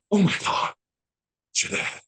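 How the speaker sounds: high gasped astonishment, dropping to a whisper; stunned realization. Retold or imitated. Mother recognizing the boy's father in the street, stunned. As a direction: re-enact breathy, hushed shock.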